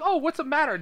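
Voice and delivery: high-pitched mock concern; concerned brother. [name] acting the concerned brother to his sister, mock concern. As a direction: play up a brother's fussing. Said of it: "concerned brother".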